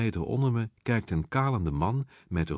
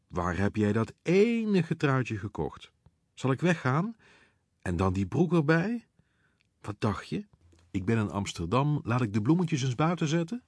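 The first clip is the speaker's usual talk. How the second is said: higher, lilting camp mockery; campy drag queen teasing; 6 semitones higher than usual. A narrator's reading. Drag queen roasting the blushing newcomer for the crowd, campy and teasing. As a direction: play to the crowd while roasting him.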